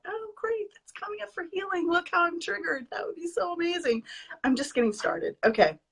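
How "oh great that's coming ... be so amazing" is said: high, chirpy "oh great" as if thrilled; cheerful acceptance. Pretend. Imagining a happy reaction to a trigger, chirpy and high. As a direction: pretend to be glad about being triggered. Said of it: "had a trigger and thought".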